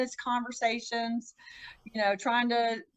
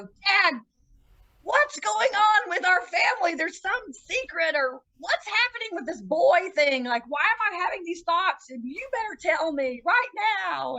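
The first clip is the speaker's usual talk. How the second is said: confronting dad; 7 semitones higher than usual. Retold or imitated. Daughter demands the family secret from her dad, upset and raised. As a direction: push him for the truth, voice raised and shaking. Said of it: "and I go".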